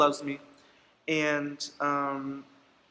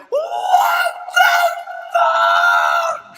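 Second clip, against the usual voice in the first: very high, drawn-out mock shriek; hammed-up furious screaming; 6 dB louder, much slower than usual. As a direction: scream it like an outraged mother, over the top. Acting out mom's furious reaction.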